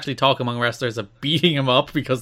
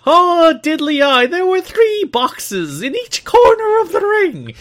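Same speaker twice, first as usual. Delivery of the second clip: put-on Irish accent; 7 semitones higher, 5 dB louder than usual. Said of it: "Please say it in your thickest accent possible".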